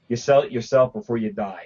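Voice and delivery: ordinary talk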